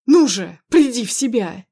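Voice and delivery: loud, insistent order; urgent command. Acted. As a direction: demand that she pull herself together. Husband snaps at wife mid-quarrel.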